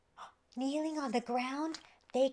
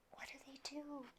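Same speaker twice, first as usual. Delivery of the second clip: put-on a whispered question to the kids; a soft teasing whisper to the listeners; hushed question; 5 semitones higher, 13 dB quieter than usual. A narrator's reading. Narrator asks listeners.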